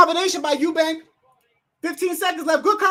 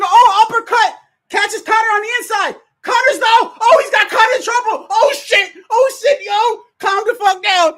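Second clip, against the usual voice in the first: high, loud shouts as the fighter gets hurt; excited shouting; 6 semitones higher, 5 dB louder than usual. Real, not acted. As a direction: yell each punch as it lands. Fighter gets hurt, live reaction.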